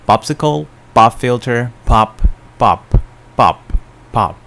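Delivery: loud, punched P's in "popsicle, pop filter, pop"; popping P sounds. Shown as a demonstration. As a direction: hit each P in "pop" hard, word by word, to pop the mic. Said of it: "Pop noises also gets pretty loud".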